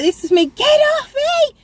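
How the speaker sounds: frantic high scream at him; panicked high-pitched shriek. Retold or imitated. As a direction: scream it, terrified, trying to get him off. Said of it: "I was there screaming".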